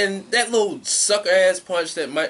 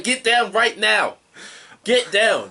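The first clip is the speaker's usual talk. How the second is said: higher, raised voice imitating a yelled order; mock yell; 6 semitones higher, 4 dB louder, slower than usual. Co-host acts out Gulak at the man on the top rope, mock yell. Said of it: "Gulak's just yelling".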